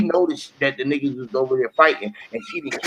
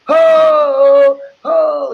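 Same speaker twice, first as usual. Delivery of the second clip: higher, louder crowd hype shout; party crowd shout; 9 semitones higher, 7 dB louder than usual. Retold or imitated. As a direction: shout like the whole room. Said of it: "The whole party said".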